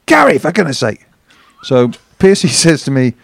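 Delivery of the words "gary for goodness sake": much higher calls of the name, fed up; exasperated shouting. Retold or imitated. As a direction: yell for him, losing patience. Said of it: "then he comes out again".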